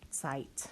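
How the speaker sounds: Zeit whispered with a German ts; German diction demo. Shown as a demonstration. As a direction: whisper Zeit with a sharp ts onset.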